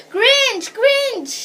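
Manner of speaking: high, loud alarm cries; yelling. A narrator's reading. Girl warning villagers from the cliff, yelling. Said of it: "she yelled".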